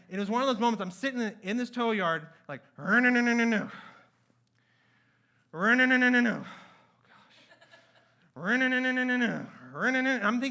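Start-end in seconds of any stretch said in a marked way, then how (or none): 2.8-10.2 loud, rhythmic mock starter-motor noise; imitated engine cranking. Engine cranking in tow yard.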